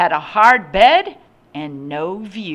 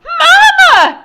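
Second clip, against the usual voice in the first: put-on very high, loud child's shriek; 15 dB louder than usual. A narrator's reading. Boy calling his mother in alarm, piercing shriek. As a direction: shriek for your mother at full force. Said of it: "shrieked Cranston".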